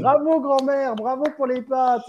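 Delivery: hammed-up enthusiastic congratulations; cheery interviewer. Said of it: "Et après, ça fait".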